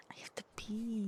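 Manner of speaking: unvoiced whispered aside; whispering. Real, not acted. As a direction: drop to a whisper. Whispered aside while playing.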